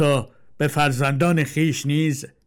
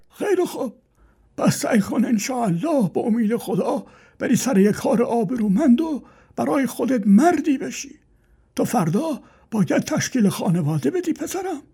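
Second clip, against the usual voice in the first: put-on high old man's voice pleading; old father warns son about bad friends; frail old father; 10 semitones higher than usual. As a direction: beg the son gently as an old man.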